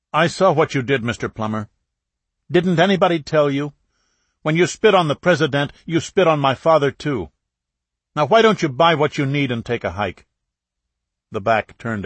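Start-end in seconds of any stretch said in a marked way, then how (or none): 0.1-10.3 loud, high, accusing; angry confrontation. A narrator's reading. Son angrily confronts the reporter about his father. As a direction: throw it in his face.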